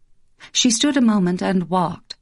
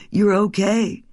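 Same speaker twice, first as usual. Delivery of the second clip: soothing; 4 semitones higher than usual. Acted. Husband soothes woken wife.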